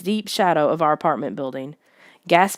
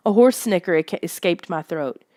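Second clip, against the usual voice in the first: plain reading voice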